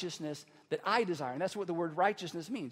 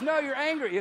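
high, louder retold rebuttal; mock contradiction; 6 semitones higher, 6 dB louder than usual. Counselor correcting frustrated client, raised insistent contradiction. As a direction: fire back the correction.

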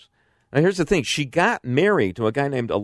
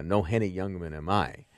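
ordinary talk, nothing marked

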